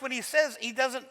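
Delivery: usual speaking voice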